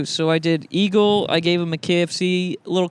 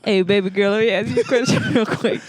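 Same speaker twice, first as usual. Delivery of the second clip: flirty guy voice; 3 semitones higher than usual. Pretend. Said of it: "thirty-five-year-old fat guy".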